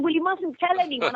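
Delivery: high conspiratorial director. Retold or imitated. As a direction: play him sharing a secret. Director voiced secretively.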